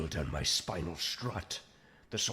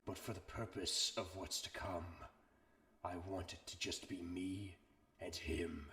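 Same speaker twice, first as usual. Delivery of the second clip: much softer, menacing undertone; quiet, ominous aside about Starscream; hushed; 10 dB quieter than usual. Acted. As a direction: drop to a quiet threat.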